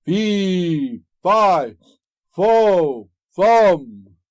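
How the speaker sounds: loud, high booming giant chant; ogre's cry. A narrator's reading. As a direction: roar it out like a hungry giant. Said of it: "cried out the ogre".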